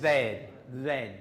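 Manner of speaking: "Then" with a buzzing voiced TH. Shown as a demonstration. Teacher models the voiced th in "Then" for the class. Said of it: "which is the voiced of these two".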